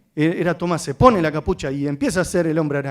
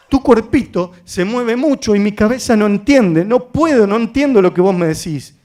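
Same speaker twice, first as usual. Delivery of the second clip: put-on louder, firm address to a child; insistent, raised voice; emphatic explanation; 3 semitones higher, 5 dB louder than usual. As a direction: spell it out forcefully to a restless kid. Retells firm intervention to child.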